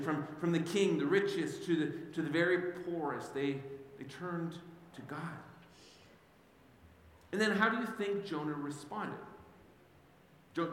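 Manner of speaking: ordinary talk, nothing marked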